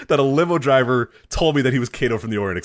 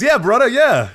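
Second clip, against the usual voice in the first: high, loud, hyped wrestler-style driver; limo driver voice; 12 semitones higher, 8 dB louder than usual. Retold or imitated. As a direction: ham up the driver's eager agreement.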